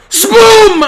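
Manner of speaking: big celebratory shout. Real, not acted. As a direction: yell it out in triumph.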